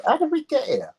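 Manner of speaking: high, wondering question to a bandmate; awed wonder. Retold or imitated. Musician asking his bandmate, high and awed. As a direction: marvel aloud in disbelief. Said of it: "I said to him".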